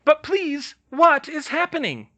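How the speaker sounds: very high, piping little professor; piping Flitwick. Acted. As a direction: pipe up politely. Flitwick again.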